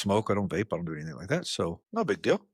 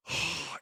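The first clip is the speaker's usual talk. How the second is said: imitated blowing out of held smoke; long exhale; 9 dB quieter than usual. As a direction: let a held breath gush out, wordless. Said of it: "all this smoke comes out of her mouth".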